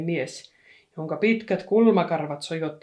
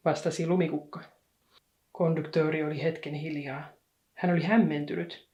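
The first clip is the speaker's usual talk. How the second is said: ordinary delivery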